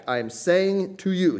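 high, slow, deliberate declaration; emphatic highlighting. Acted. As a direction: underline every word, pitched high and drawn out. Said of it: "he's highlighting this".

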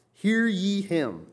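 Hear ye him, high, loud and drawn out slowly; emphatic command. Shown as a demonstration. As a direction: proclaim Hear ye him like a voice from heaven. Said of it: "is an emphatic sense".

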